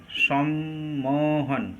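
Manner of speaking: slow, segmented সম্মোহন, as সম-মো-হন; syllable split. Shown as a demonstration. Teacher counts open syllables in সম্মোহন, syllable split. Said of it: "এখানে কয়টা মুক্ত দল আছে".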